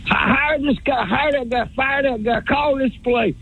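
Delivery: high-pitched parody of armchair bosses; mock bossy caller. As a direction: rattle off orders in a high mocking voice. Mocks callers giving orders.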